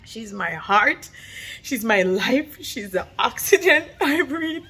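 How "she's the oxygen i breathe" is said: laughing through tears. Real, not acted. Mom tearing up about daughter.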